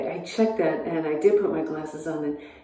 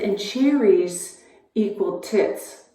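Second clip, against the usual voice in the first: slow deadpan; much slower than usual. Retold or imitated. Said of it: "And then she said".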